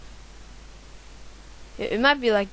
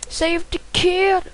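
higher, slow sing-song reading; drawn-out high; 7 semitones higher, slower than usual. Pretend. Gamer reading the level name, slow high sing-song. Reads level title twice.

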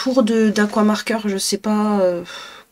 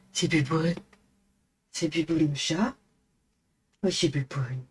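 low cooing pet voice; 4 semitones lower, 6 dB quieter than usual. Real, not acted. Owner showing the cat itself on camera, low cooing. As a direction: coo gently, showing the cat the screen.

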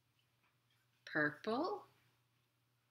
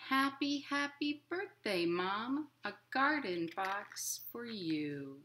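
gentle, lilting picture-book reading; reading to children; 3 semitones higher, 4 dB quieter, slower than usual. A narrator's reading. Librarian reading a picture book to children, lilting. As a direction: read it like storytime for little kids.

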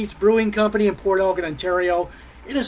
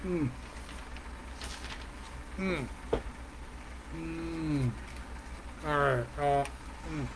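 talking round a cigar; mumbled past a cigar, puffing; cigar in mouth; 3 semitones lower, 16 dB quieter than usual. Real, not acted. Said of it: "my cigar keeps going out".

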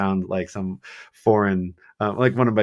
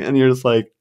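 ordinary talk

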